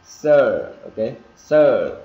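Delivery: loud, stretched "er" in "sir"; emphatic, lengthened "sir"; R-coloured vowel. Shown as a demonstration. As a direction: lean on the curled R ending of "sir". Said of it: "Nghe nó hơi ờ một tí".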